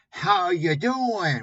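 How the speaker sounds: slow, high, loud put-on American greeting over a Singaporean accent; mock masked Singaporean accent. Mimicking a Singaporean guru faking an American accent, slow and loud. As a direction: drawl "How you doing" like a guru hiding his Singaporean accent. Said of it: "mask his Singaporean accent".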